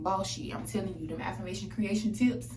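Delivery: ordinary talk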